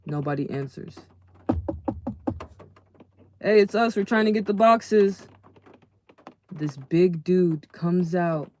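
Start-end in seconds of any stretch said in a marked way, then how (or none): 3.4-5.3 loud call at a stranger's door. Retold or imitated. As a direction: call out to the house, loud and high.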